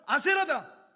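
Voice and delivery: high, loud announcement; loud proclamation. Acted. Pharaoh pointing out Baal to Moses, proclaiming loudly. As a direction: proclaim the idol's name.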